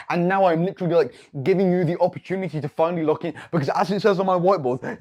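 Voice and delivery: ordinary talk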